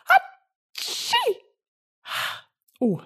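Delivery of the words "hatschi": high, hammed-up fake sneeze; mock sneeze. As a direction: let out a squeaky pretend sneeze. Fake sneeze after muting.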